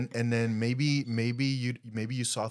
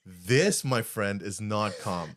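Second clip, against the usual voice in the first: usual speaking voice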